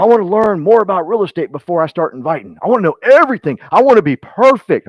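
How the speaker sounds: high, loud imitation of a perfectionist recruit; mock overeager protest. Recruit putting off inviting people, mock-insistent and loud. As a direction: pitch it up and exaggerate every demand. Mocks hesitant recruits' excuses.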